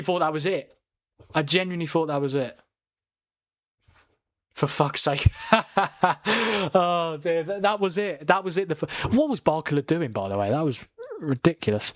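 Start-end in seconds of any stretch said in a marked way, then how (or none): 5.3-6.7 rueful laugh. Real, not acted. Laughs after big miss.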